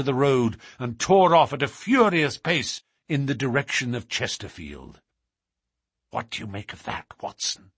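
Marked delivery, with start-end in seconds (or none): 6.1-7.7 hushed whisper, much softer. Acted. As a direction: whisper so no one hears. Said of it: "Holmes whispered".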